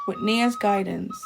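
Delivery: usual speaking voice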